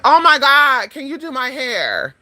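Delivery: high, drawn-out mock gushing; effusive auntie. Auntie running into her relative at the checkout, high effusive greeting. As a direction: imitate an auntie pouncing with a favour to ask.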